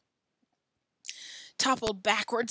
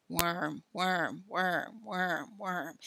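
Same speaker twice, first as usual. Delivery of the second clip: obsessive repetition; 3 dB quieter, much slower than usual. Acted. Billy unable to stop thinking of worms, obsessive droning. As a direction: repeat it like a stuck thought.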